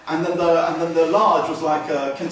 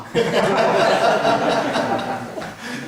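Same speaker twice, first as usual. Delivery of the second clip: big laugh; 7 semitones higher, 7 dB louder than usual. Real, not acted. Laughs at his punchline.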